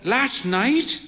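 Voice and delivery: put-on frail old mother. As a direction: play the bird-faced old mother, cautious. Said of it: "she said".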